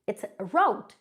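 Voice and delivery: route said "rowt" like out; correct pronunciation. Shown as a demonstration. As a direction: calmly give route as "rowt". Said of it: "It's not correct".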